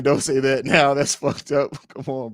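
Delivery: ordinary delivery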